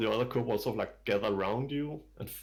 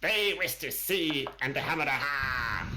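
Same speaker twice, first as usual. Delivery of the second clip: kobold guide; 5 semitones higher than usual. Acted. Kobold guide presents party.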